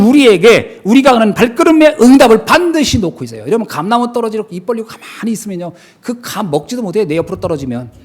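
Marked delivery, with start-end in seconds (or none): none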